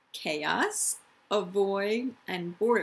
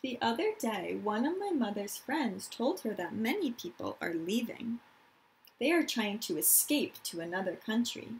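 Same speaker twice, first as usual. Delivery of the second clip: calm storytime reading; reading to children. Acted. Teacher reading a picture book to her class, gentle storytime.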